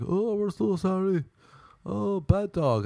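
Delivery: high-pitched, drawn-out fussing apology; mock apologetic. Neighbors excusing their dog after it bit him, mock apologetic.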